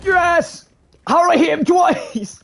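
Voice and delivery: loud high excited yell; excited shout. Real, not acted. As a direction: yell in disbelief at the hit.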